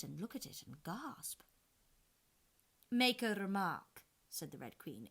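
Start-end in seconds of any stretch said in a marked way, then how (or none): 2.9-4.0 loud, slow command; queen's order. A narrator's reading. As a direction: prod the girl to talk. Red Queen orders Alice.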